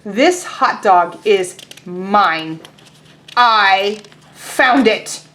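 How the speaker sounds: very slow, firm pigeon; stubborn pigeon. Acted. Teacher voicing Pigeon insisting the hot dog is his, very slow. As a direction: dig in and claim it.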